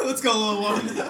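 slow, loud, high and laughing; drawn-out joking summons; mock rallying call. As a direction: playfully call a little one along. Acting out baby leading family away.

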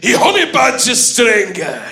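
ordinary talk

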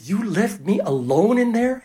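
slow, high incredulous question; acted-out reproach; indignant disbelief. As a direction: draw it out, appalled at being abandoned. Retold indignant reproach to friend.

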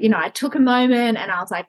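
usual speaking voice

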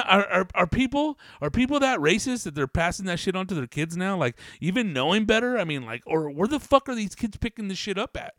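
loud, high, indignant ranting; worked-up outraged vlogger rant. Real, not acted. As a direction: rail at it in disbelief. Ranting outrage at racist kids.

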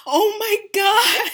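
slow, high laughing oh my God; real giddy laughter. Real, not acted. Laughing at her own joke.

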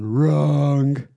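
loud, drawn-out interjection; Donald Trump. Retold or imitated. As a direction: cut in with a blaring mock-politician "wrong". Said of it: "with Trump going".

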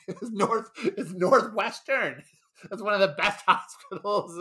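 laughing insistence; chuckling protest. Real, not acted. Laughing defending the hospital.

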